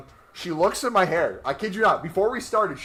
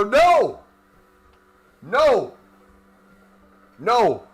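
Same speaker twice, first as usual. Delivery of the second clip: shouted no, higher and louder; loud refusal; 5 semitones higher, 8 dB louder, much slower than usual. Real, not acted. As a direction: refuse loudly, half joking. Refusing a buzz cut.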